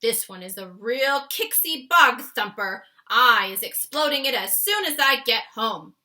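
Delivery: loud, vehement warning; loud vehement giant's warning; fierce. Acted. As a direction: press the danger hard. Giant warns of nightmare.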